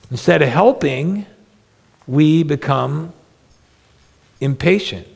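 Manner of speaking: usual speaking voice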